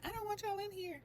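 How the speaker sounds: imitating tearful storming off. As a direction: retell her exit in a high, upset pitch. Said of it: "She told the cameraman".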